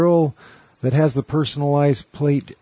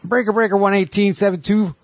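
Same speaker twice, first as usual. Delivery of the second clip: CB trucker radio talk; 3 semitones higher than usual. Retold or imitated. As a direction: call it in like a trucker over the radio. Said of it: "they all talk like that".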